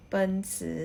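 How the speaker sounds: "เปิ้นซือ" said as Mandarin ben-chi with a falling first syllable; "เปิ้นซือ" in Chinese tones; Mandarin reading. Shown as a demonstration. Teacher giving the Chinese name for Mercedes-Benz, Mandarin tones. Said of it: "คนจีนจะอ่านว่า".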